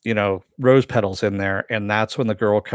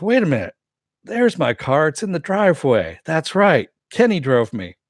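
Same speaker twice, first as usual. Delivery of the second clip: higher-pitched mock realisation; lively retold puzzlement, pitched up; acted sudden realisation; 6 semitones higher, 3 dB louder than usual. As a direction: act out working it out aloud. Acts out Tobias spotting his car.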